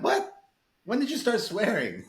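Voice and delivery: high, startled squeak; mock shock. Mom suddenly starts swearing, a high startled squeak. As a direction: act amazed at mom. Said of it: "I'm like".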